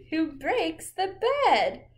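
slow, loud climax; dramatic climax. Pretend. Reading the climax to the child, slow and loud. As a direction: land the big moment.

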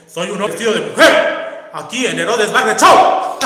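shouting on request. Shown as a demonstration. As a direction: belt it out like a show announcement. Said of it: "Hermana, grita un hombre vestido de mujer". (grito a todo pulmón)

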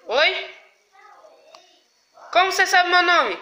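high, loud panicked question; panicked shout. Real, not acted. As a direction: yell in real fright. Frightened by voice knowing his name.

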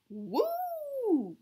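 high, thrilled whoop; gleeful whoop. A narrator's reading. Penny enjoying the wild flight, high gleeful whoop. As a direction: whoop like on a roller coaster. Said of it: "shouted Penny".

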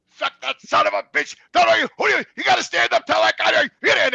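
loud, high, shouted pep rant; going off. Retold or imitated. Pumping up his down brother on the phone, shouting. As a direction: yell it to fire him up. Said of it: "I go off on the phone".

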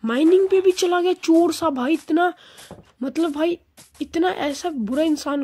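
high, loud indignant complaint; exasperated outburst. Real, not acted. Complaining loudly about teammate's thieving.

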